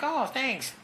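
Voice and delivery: high, dismissive thank-you; mock thanks. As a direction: toss off the compliment in a high voice. Brushing off a compliment.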